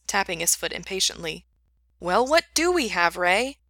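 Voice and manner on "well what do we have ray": impatient. Acted. Impatient demand.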